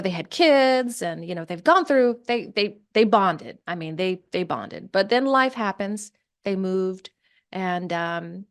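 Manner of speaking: usual speaking voice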